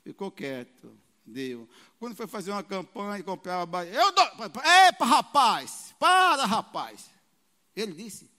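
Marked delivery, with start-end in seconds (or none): 3.9-7.1 booming cut-off, slowing at the end; loud interruption. Retold or imitated. Pastor shouting down the showoff from the pulpit, loud and commanding. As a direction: stop him in his tracks.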